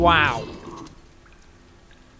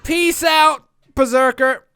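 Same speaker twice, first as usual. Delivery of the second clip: cheery call; 6 semitones higher, 5 dB louder, slower than usual. Real, not acted. Sign-off call to player.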